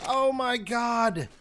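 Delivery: drawn-out real cry of disbelief; slow anguished shout. Real, not acted. Player finds every resource deleted, anguished shout. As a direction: wail it out slowly.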